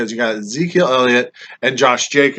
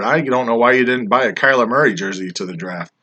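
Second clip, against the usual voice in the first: usual speaking voice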